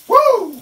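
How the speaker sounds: high, loud happy whoop; excited whoop. Real, not acted. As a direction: whoop with delight at the smell. Excited whoop over the smell.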